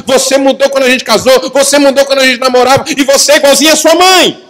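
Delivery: high voice building to loud accusations; comic marital row at full pitch; escalating acted quarrel. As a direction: pile up grievances, climbing to a shout. Acted quarrel escalating between spouses.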